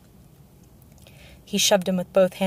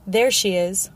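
mildly raised exclamation; restrained shout; 4 dB louder, slower than usual. A narrator's reading. King spots the missing girl, mildly shouted. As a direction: call out with a little force. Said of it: "Joffrey shouted".

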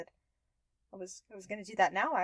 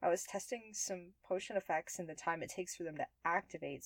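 ordinary talk

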